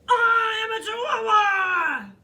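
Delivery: put-on very loud, high, drawn-out cry in a Spanish accent; triumphant yell with a Spanish accent; Spanish-accented shout. A narrator's reading. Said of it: "using his very best Spanish accent".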